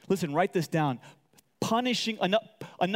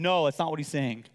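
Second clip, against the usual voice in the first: usual speaking voice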